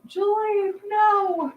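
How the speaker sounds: high-pitched teasing reproach; playful high no. Real, not acted. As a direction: squeak a mock scolding. Playful scolding of a viewer.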